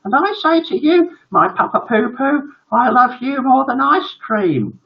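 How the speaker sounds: put-on very high, sugary child voice; adoring little son. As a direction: declare love to papa like a little boy. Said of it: "turned to his father and said".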